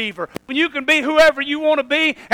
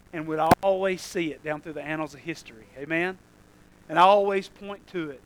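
much softer and lower, intimate; hushed preaching; 7 semitones lower, 10 dB quieter than usual. Acted. Preacher softly telling the congregation of Calvary. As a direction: draw them in with a near-hush.

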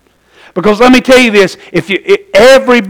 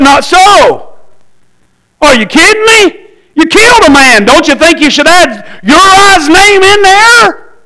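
higher, rising protest of disbelief; indignant disbelief; 7 semitones higher than usual. Retold or imitated. Preacher retelling his first reading of David's prayer, indignant. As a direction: push back as if you can't believe it. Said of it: "When I first read that, I said".

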